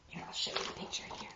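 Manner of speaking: quick hushed whisper. Real, not acted. Reader whispering while showing the picture. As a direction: rush it out in a whisper.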